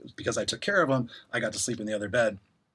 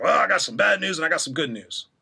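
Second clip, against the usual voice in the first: higher, slightly put-on voice teasing the news; a lightly voiced older boss with a playful reveal; hint of a teasing boss; [name]'s announcement; 5 semitones higher, 4 dB louder than usual.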